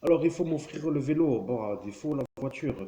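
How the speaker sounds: near-shout insisting he be given a bike; loud demand. Real, not acted. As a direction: push the demand hard and loud.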